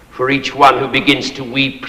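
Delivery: ordinary talk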